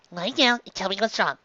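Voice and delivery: plain reading voice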